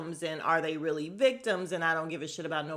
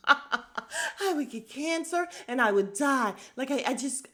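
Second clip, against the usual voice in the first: slow, laughing mock quote; mock the line while laughing; mocking through laughter; 5 semitones higher than usual. Mocking Renna's cancer line, laughing through it. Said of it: "mocking Renna's line, laughing".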